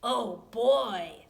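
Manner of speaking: big booming dinosaur voice; loud booming Papa. Acted. Papa Dinosaur booming to his family. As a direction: boom it out like a giant dad. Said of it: "in his loud, booming voice".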